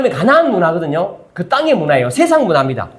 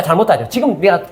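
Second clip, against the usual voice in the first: ordinary talk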